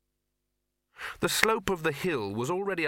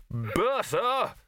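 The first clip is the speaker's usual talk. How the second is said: high, forceful call; incandescent bellow; 11 semitones higher than usual. Acted. Red-faced archchancellor calls the bursar, incandescent. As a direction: summon him in a rage.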